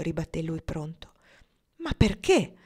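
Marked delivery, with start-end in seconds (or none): 1.8-2.5 much higher and louder, disbelieving; incredulous question. Acted. Woman doubting the man who proposes, incredulous and loud. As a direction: fire the question back in disbelief. She challenges his proposal.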